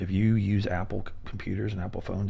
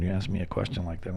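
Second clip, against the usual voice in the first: normal talking voice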